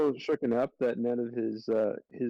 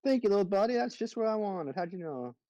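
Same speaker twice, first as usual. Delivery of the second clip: high sweet voice; 8 semitones higher than usual. Acted. Thanking the squirrel sweetly.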